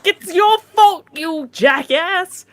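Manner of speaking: high, slow mock yell at the senator; imitated yell. Retelling yelling at the senator, high mock yell. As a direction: hurl the blame at him, high and drawn out. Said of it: "And I was like".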